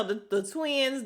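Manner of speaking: ordinary delivery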